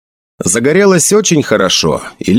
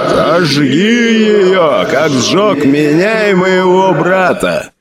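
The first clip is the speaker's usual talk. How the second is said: very slow, higher growl through the pain; father's growl; 8 semitones higher, much slower than usual. Acted. Burning father urges Sedjin on, slow pained growl. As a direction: stretch every word like a tortured man. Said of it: "прорычал отец между криками".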